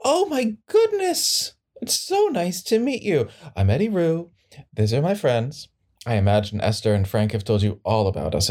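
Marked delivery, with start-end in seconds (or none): 0.0-5.7 gushing Eddie Rue. Acted. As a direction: charm the new attorney with a high sweet voice. Eddie Rue greets the attorney.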